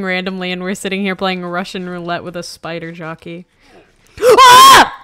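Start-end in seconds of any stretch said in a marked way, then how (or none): 4.2-4.9 sudden high startled scream. Real, not acted. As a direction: shriek in fright. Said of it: "What's that fucking manly scream".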